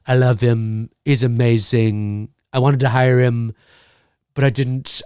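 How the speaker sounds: a lightly voiced gushing fan of Mike; imitating him. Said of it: "He's like".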